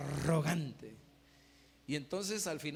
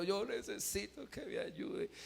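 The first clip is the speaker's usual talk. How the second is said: higher, softer mock-crying voice; imitated tearful plea; 6 semitones higher, 4 dB quieter than usual. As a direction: beg with an acted whimper. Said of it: "ese hombre le lloraba y dice".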